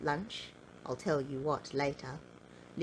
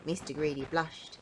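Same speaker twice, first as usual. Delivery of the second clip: ordinary delivery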